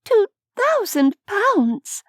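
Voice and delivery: stunned gasp at "Two thousand pounds?" rising high; astonished echo. A narrator's reading. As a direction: repeat the sum as if you can't believe it. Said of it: "Nevaeh echoed".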